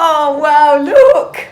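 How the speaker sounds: high thrilled exclamation; excited. Real, not acted. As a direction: gasp it out, delighted. Excited greeting on camera.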